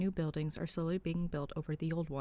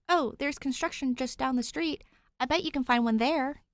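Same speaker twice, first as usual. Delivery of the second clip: put-on higher, helpful young ghost; eager ghost voice; 8 semitones higher, 6 dB louder than usual. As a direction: chirp it out helpfully.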